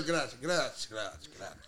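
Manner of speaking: soft grazie, grazie, fading low and quiet; Italian grazie demo. Shown as a demonstration. Showing the host how an Italian says grazie, fading soft and low. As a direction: trail off the grazie like a gracious Italian. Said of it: "When you do".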